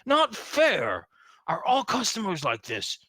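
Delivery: petulant orc complaint, pitch jumping up; hissy fit. Acted. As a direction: whine it like a thwarted bouncer. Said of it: "in a hissy fit-like manner".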